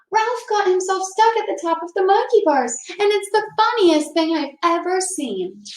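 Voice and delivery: put-on gleeful kid calling out the news; mock yell. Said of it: "Tony yelled".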